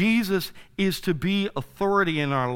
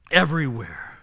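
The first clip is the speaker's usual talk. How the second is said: normal talking voice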